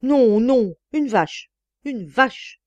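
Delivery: loud, high drawn-out refusals, then repeated insult; exasperated husband refusing to be calmed; growing exasperation. A narrator's reading. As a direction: burst out in angry protest. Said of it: "il s'exaspérait davantage".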